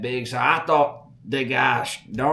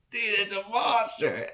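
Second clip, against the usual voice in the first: higher, awestruck gasp of a line; awed; 9 semitones higher than usual. Retold or imitated. Boy to the old hunter, awestruck and higher. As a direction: breathe out your awe. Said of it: "And I'm going".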